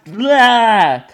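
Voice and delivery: loud growling vampire blah; vampire blah cry. Acted. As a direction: bellow it like a vampire. Vampire podcast opening cry.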